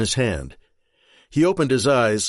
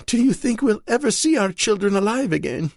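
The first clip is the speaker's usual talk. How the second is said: higher, pleading wife close to tears; Marion, desperate wife; 11 semitones higher than usual. Acted. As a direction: beg him for a shred of hope. Marion sobbing into chest.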